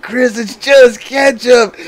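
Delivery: high, loud, slow gleeful reveal; laughing reveal. Retold or imitated. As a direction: savor the punchline through laughter. Revealing prank, hand up.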